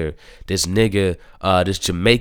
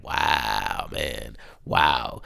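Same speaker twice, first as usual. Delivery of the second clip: much softer, lower, hushed awe; awed wow; 10 semitones lower, 8 dB quieter, much slower than usual. Real, not acted. Fan amazed by the capoeira fight, hushed awe. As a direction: breathe out in amazement.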